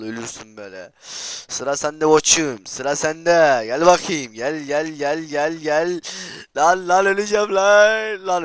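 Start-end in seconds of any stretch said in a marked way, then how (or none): 1.5-6.0 loud, slow menacing challenge; loud taunt. Real, not acted. Gamer taunting an opponent in the game, loud and slow. Taunting next victim loudly. 6.6-8.4 high, loud, slow panicked cry; panicked yell. Real, not acted. Gamer yelling in panic that he is dying.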